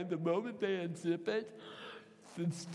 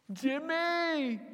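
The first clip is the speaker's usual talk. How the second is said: calling out; 9 semitones higher than usual. Retold or imitated. Calling out in the night.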